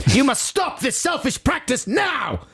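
high, slow hammed-up yell; outraged mock shout. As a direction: shout it like a furious complainant. Acting out an outraged letter.

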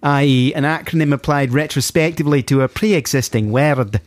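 broad put-on Glaswegian lecture; Glaswegian accent. Host reading out a listener's word history, Glaswegian accent. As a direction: explain it in an exaggerated Glasgow accent. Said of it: "I should do the correct accent".

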